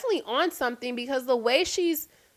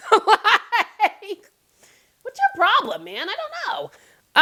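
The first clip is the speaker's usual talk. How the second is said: higher with a laugh, baffled; laughing exasperation; 8 semitones higher, 3 dB louder, slower than usual. Real, not acted. Fed up with Fantana, laughing and much higher.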